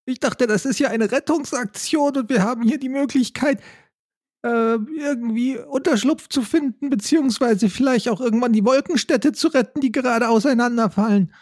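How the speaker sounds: high, fast-talking raccoon character. Acted. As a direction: chatter high and bewildered.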